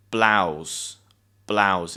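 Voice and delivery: "Blouse" said low and alone, rhyming with cows; pronunciation demo. Shown as a demonstration. Teacher showing learners the word, careful pronunciation of "Blouse". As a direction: model "Blouse" as one clear syllable, falling. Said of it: "explain is the pronunciation of this word".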